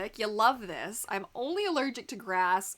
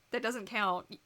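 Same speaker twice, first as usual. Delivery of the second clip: normal talking voice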